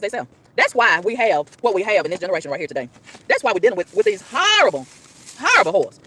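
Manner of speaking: loud, fervent preacher's sermon; preaching. Acted. Driver sermonizing on raising children, fervent preaching. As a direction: preach it hard. Preaching at raised force about parenting.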